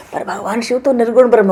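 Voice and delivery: ordinary talk, nothing marked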